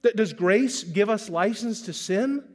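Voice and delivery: ordinary delivery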